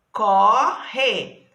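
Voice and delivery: "Corre" split loudly into co, rre; syllable by syllable. Shown as a demonstration. Teacher counts two syllables for pupils, "Corre" loudly split in two. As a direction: stretch both syllables of "Corre", separately. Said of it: "Tem duas sílabas".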